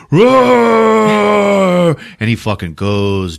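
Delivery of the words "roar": long high mock roar; beast roar. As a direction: roar like a monster as it is struck, loud and drawn out. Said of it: "he's like".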